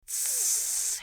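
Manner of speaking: sharp high hiss; the rats hiss at the crying rat. Acted. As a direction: hiss in scorn. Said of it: "hissed the other rats".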